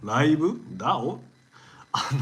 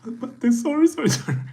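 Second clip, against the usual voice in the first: flustered apology; 11 semitones higher, 7 dB louder, faster than usual. Real, not acted. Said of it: "louder flustered apology".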